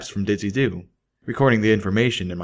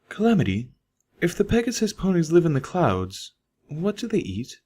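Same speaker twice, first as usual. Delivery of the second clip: higher, lightly sung-out curious mare; higher voiced Velvet puzzling over sky ponies; 9 semitones higher than usual. A narrator's reading. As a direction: ask it with genuine puzzlement, pitched up. Velvet asks Calamity a question.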